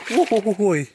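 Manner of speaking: high, loud woo-hoo yelps; nervous high hooting. Real, not acted. Vlogger wading the pond barefoot, high nervous hooting. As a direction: hoot your way through the cold water.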